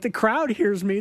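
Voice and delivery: usual speaking voice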